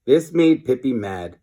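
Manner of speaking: plain reading voice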